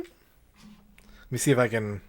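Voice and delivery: normal talking voice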